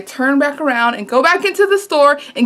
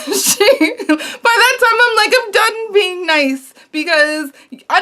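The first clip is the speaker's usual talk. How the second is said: laughing through the words, drawn out; amused laugh mid-story; 8 semitones higher than usual. Real, not acted. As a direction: let the laugh carry the words. Laughing at her own story.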